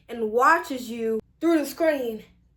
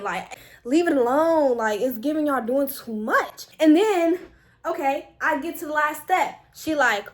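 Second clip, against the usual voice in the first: ordinary talk, nothing marked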